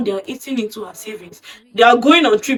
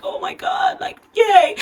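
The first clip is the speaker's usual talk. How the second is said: squealing excitement; 11 semitones higher than usual. Retold or imitated. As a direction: squeal with girlish delight. Wife thrilled about wedding plans.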